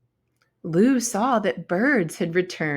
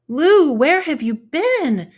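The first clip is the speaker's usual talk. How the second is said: higher, louder eager call; excited call; 9 semitones higher, 7 dB louder than usual. Acted. Ladybug friend finding Lou, calling out excited.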